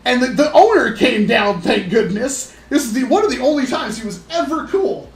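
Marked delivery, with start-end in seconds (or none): none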